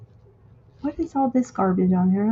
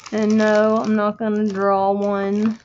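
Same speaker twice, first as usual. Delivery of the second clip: ordinary talk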